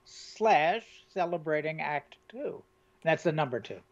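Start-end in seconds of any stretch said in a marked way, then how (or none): 0.0-2.6 slow, deliberate spelling out; slow and clear. Shown as a demonstration. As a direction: read the web address out slowly. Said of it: "spelling address slowly for listeners".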